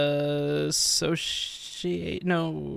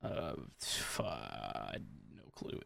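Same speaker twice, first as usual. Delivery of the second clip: much softer, under the breath; muttered to self; 17 dB quieter, much slower than usual. Real, not acted. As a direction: mumble it, stumped. Stumped on quiz question.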